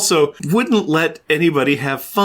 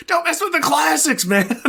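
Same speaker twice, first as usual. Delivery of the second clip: mock Ben voice; 14 semitones higher than usual. Ben quoted.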